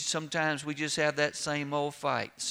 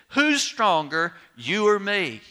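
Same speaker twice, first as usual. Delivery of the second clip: challenging shout; 5 semitones higher, 7 dB louder than usual. Retold or imitated. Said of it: "looked at the pack of cigarettes and said".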